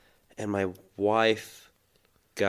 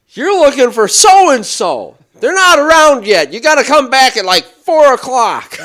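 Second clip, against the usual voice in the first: Fred's hollered directions; 15 dB louder than usual. Retold or imitated. Fred sends stranger away till later, hollering. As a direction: shout helpful information as if still angry. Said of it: "And he goes".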